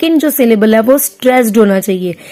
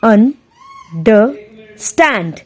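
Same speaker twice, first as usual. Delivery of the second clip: "Understand" very slow, with long gaps between its three syllables; teacher isolating each syllable of "understand"; syllable-by-syllable demo; much slower than usual. Shown as a demonstration. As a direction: break "understand" into widely spaced beats. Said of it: "ये दोनों वीक syllable हैं".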